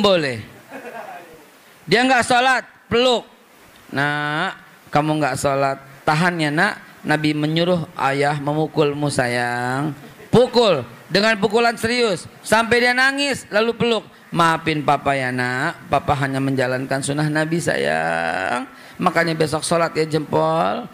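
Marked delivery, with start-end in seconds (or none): 3.9-9.9 lower, hushed, affectionate father; tender father. Retold or imitated. Father tenderly explaining punishment. 14.3-20.9 lower, gentle dad apologising; comforting father. Retold or imitated. Father comforting crying child.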